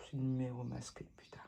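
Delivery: hushed whisper, breath only. Real, not acted. Spotting an unknown caller, she whispers. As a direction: mutter it under your breath, annoyed. Reacting to a hidden-number call.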